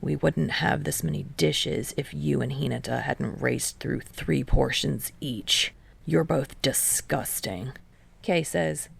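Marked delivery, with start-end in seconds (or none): 0.0-7.7 lower, softer, a hint of disdain; lightly voiced scornful Kei; Kei's voice. A narrator's reading.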